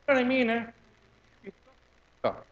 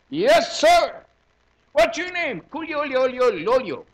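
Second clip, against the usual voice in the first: army swearing-in; 6 semitones higher, slower than usual. Retold or imitated. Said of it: "I go".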